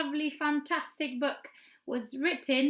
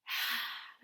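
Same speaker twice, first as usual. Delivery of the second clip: soft breathy sigh out; 6 dB quieter than usual. Shown as a demonstration. Teacher showing the sign's sound, a sad sigh. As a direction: let out a sad little sigh. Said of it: "A sigh, we go like".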